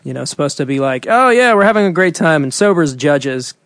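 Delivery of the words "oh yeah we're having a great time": forced party enthusiasm; fake cheerful. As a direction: pretend to be having fun. Faking fun while sober on stage.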